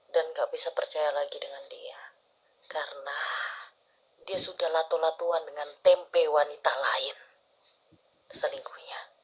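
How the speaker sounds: gossipy hushed whisper; soft conspiratorial whisper. Real, not acted. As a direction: whisper the scandal. Secretive gossip about cheating.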